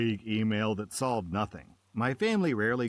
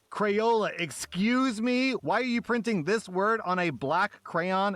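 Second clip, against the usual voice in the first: much higher, louder mock outrage; hammed-up offended customer demanding answers; indignant complainer; 10 semitones higher, 3 dB louder than usual. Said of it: "some American will be like".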